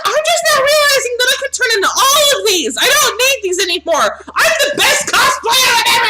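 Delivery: high-pitched excited proclaiming; overjoyed kid. Acted. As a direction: gush at full excitement, voice way up. Acts overjoyed kid discovering cosplay powers.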